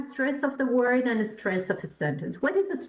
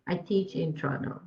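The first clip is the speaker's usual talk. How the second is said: lower, "Toronto" given no emphasis; robotic, no stress; 7 semitones lower, 4 dB quieter than usual. Shown as a demonstration. Teacher showing a poor answer, robotic and unstressed. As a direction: say "Toronto" as evenly as the rest. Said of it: "That's not very understandable".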